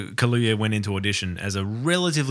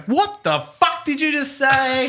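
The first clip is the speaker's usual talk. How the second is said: high, drawn-out mock bafflement; exasperated straining listener; 14 semitones higher, slower than usual. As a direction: stretch it out as a fed-up viewer who can't hear. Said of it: "you're leaning in to go".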